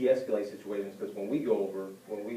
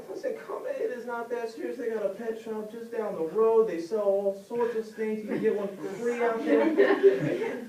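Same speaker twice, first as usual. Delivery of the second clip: high, drawn-out mock soothing; hammed-up breezy brush-off, slow and high; dismissive vet; 11 semitones higher, 5 dB louder than usual. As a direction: talk down a mourner, stretching the words. Vet brushes off grieving pet owner.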